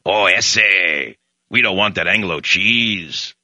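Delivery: a hint of a mocking Mexican accent. Host mocking immigrants in a lightly put-on Mexican accent. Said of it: "immigrants primarily from Mexico who won't".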